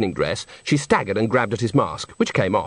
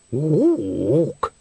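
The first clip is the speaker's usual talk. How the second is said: ordinary delivery, nothing marked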